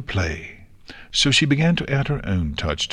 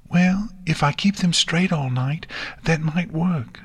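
mumbling to herself; 7 semitones higher than usual. A narrator's reading. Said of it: "Nix mumbled to herself".

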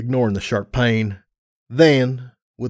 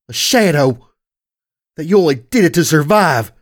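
raised, impassioned reproach; anguished accusation; 4 semitones higher, 5 dB louder than usual. Acted. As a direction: accuse a beloved brother, voice lifted in anguish.

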